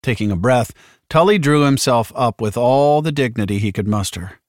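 ordinary delivery, nothing marked

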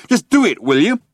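high, loud exasperated demand; shouting. A narrator's reading. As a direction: bark the order. Zaphod shouting on.

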